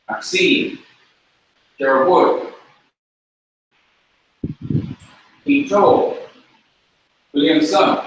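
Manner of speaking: each name, Maxine to Roy, punched on its final syllable; last-syllable stress. Shown as a demonstration. Teacher calling students' names Hebrew-style, stress on last syllable. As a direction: lift and lean on the end of Pinto, Williamson, Richard, Boyer. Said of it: "accent is going to come on the very last".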